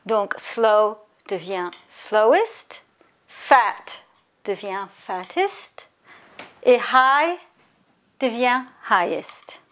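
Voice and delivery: slow, careful teacher read of each example word; slow teaching demo. Shown as a demonstration. Teacher to students, modelling the superlatives slowly. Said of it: "teacher models the examples slowly".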